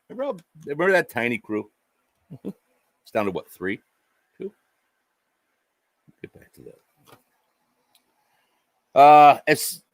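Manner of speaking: ordinary talk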